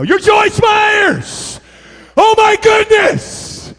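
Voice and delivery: high, loud, slow starstruck shout; starstruck gush. Retold or imitated. Starstruck reply to Joyce Myers.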